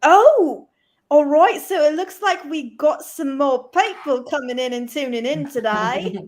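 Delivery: broad mock Australian, a laugh at the end; bad Australian accent. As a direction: put on a hammed-up, unconvincing Australian accent. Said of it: "That does not sound Australian".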